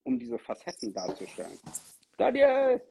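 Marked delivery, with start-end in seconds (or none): 2.2-2.8 hoch und laut den Namen rufen. Real, not acted. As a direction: ruft ihn laut herbei. Calls guest into the stream. (laut nach Daniel rufen)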